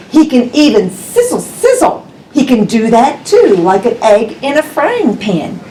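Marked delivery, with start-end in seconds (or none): none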